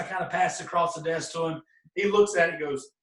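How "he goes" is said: ordinary talk, nothing marked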